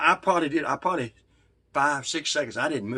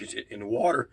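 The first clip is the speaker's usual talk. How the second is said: ordinary talk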